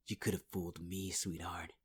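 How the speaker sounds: ordinary talk, nothing marked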